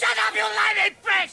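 slow, loud furious yell; angry shout. Acted. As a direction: snap back in a rage. Doctor yells back.

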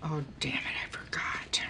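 dismayed whisper to self. Acted. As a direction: hiss it under your breath, caught out. Remembers missed lunch privately.

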